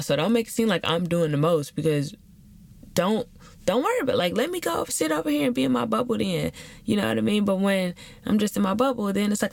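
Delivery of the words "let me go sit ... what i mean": normal talking voice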